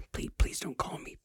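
a soft unvoiced whisper, half to himself; whispered aside. Taking back the hero offer, whispered plea. Joking aside after bragging.

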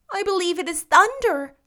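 brightly voiced young heroine fretting; Anne's lively girlish voice. A narrator's reading.

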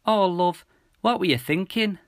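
high, tearful mum voice; sniffling mum. A narrator's reading. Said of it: "sniffed Mum".

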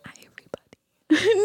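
whispered video greeting; breathy, whispered ASMR parody; mock ASMR whisper. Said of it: "making A S M R videos".

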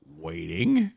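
whispered "Waiting." with a rising end; mock whisper. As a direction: breathe it out teasingly. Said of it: "mock waiting, final whisper".

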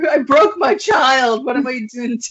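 put-on half-joking cry of alarm over the baby; replaying a new mom's self-blaming panic; mock panic. Retelling her panic over hurt baby.

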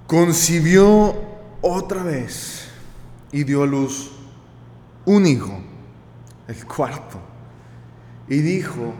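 slow, weighty reading with long pauses; dramatic scripture reading. Acted. Preacher rereads the verse to the congregation, slow and weighty. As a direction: read it out slowly, landing hard on the first word.

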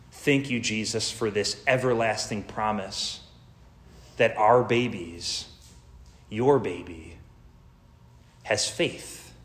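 soft, hushed prayer fading to a whisper; quiet prayer. Acted. Preacher turns to pray to Jesus, hushed and soft. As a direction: pray it gently, almost to yourself.

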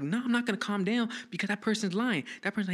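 high-pitched, indignant refusal; defiant protest. Retold or imitated. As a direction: kid arguing back at a cop. Retold refusal to calm down.

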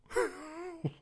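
high laugh. Real, not acted. Panic laugh mid-story.

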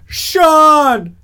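high, loud repeated desperate calls; frantic yelling. Retold or imitated. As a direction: yell the name over and over, panicking. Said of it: "will just say".

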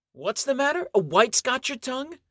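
high sneering tease; mock him like a bully; mocking taunt.

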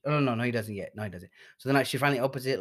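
normal talking voice

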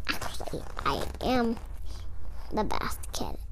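hushed, unvoiced boast; a sneaky whisper; whispered aside. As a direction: whisper it as a secret. Kid's whispered reply.

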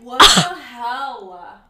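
loud, drawn-out exclamation of shock; appalled disbelief. Retold or imitated. Friend retells her reaction to the slur story, appalled disbelief. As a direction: stretch it out slowly and loudly, appalled.

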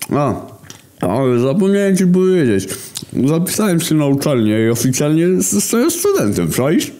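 wysoki, podpity głos Głoda; mów jak wesoły pijany student, wysoko; drunk Głód. A narrator's reading. (Głód chwali się bratu, wysoki pijany głos)

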